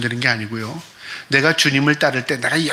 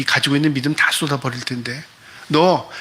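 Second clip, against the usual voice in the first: ordinary talk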